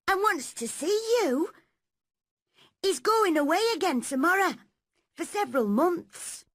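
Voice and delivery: bursting to tell the news; excited maid Martha. Acted.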